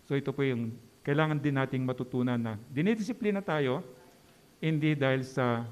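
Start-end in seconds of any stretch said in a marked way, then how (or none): none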